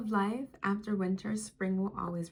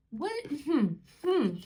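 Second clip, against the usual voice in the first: high, loud surprised hum; real delighted surprise at the card; surprised laughing hum; 7 semitones higher, 5 dB louder than usual. Real, not acted.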